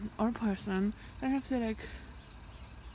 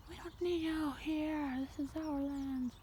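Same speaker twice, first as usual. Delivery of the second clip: slow lofty sheep; mock solemn sheep speech; 5 semitones higher, 3 dB quieter, slower than usual. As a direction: intone it like sheep claiming land. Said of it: "they're like".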